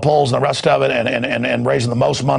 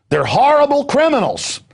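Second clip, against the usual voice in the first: ranting outburst; 10 semitones higher than usual. Real, not acted. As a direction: hit it like an alarm to the audience. Ranting host condemns criminals.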